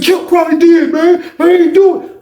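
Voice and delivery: high, loud desperate shout; panicked shout. Retold or imitated. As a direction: blame someone else in a panic. Snitch blames someone else.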